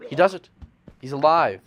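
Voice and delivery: loud, high burst of triumph; excited announcement. Real, not acted. Narrator hails the successful escape.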